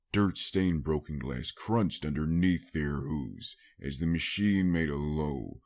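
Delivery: ordinary delivery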